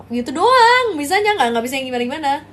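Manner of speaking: normal talking voice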